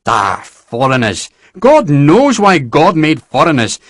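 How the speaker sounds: slow, weary scorn; exasperated general. A narrator's reading. General scoffs at foreigners, slowly. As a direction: sigh it out with disdain.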